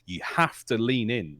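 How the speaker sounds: usual speaking voice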